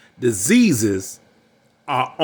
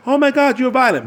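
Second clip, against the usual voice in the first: much higher, put-on woman's voice crying foul; imitating a woman, much higher, playing the victim; mock outraged woman; 11 semitones higher than usual. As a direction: squeal the accusation in a higher mock voice. Quoting a woman accusing him.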